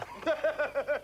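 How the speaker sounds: loud, high machine-gun cackle; laughing fit. Acted. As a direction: cackle uncontrollably at high pitch. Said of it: "He's having a fit".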